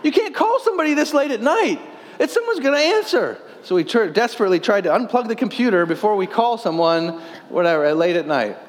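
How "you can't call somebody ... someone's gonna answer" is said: high panicked mom voice; shrill panic, high pitched. Retold or imitated. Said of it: "She's like".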